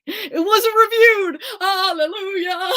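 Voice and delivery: high, loud, slow and exultant; excited. Real, not acted. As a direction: crow it with relief. Celebrating goal not reviewed.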